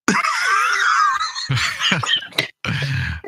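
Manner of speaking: high burst of laughter. Real, not acted. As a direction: howl with laughter.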